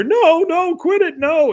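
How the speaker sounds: very high, loud whining protest; shrill mock plea from a beaten piñata; Bill pleading. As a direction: cry out for the kids to stop hitting. Bill being hit by kids.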